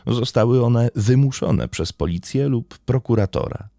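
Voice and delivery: steady, measured storytelling read; crime podcast narration. Acted. Host recounting a murder trial to listeners, in a steady narrative read. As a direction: lay out the court case like a documentary narrator.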